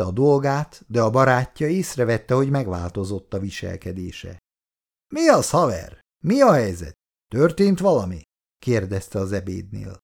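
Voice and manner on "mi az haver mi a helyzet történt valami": lively, louder prodding questions; eager questioning. Acted. Friend prodding Petya at lunch, lively and loud. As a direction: poke at him with eager questions.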